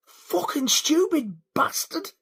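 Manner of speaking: slow, high, strained curse; retold fuming. Fuming over the parking spot, slow seething curse. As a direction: re-enact seething at a driver. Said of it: "I was fuming".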